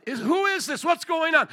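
mock alarm, pitched well up; acted alarm. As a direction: blurt it like startled onlookers. Voicing the frightened disciples' questions.

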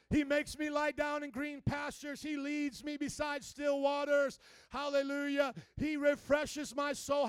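slow, loud, emphatic proclamation; declaring in faith. Shown as a demonstration. Preacher showing the congregation how to pray, declaring boldly. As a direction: proclaim it firmly, stretching each word. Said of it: "declare some things in faith".

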